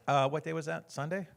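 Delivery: normal talking voice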